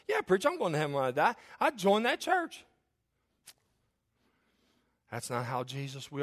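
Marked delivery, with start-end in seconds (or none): 0.1-2.6 high, eager mock self-assurance; cocksure churchgoer. Churchgoer assuring the preacher about heaven, mock cocksure. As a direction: answer the preacher with rising, overconfident pitch.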